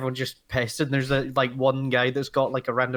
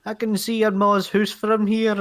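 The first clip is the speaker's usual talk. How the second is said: mock Scottish astronaut; 7 semitones higher, 3 dB louder than usual. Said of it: "astronauts just going".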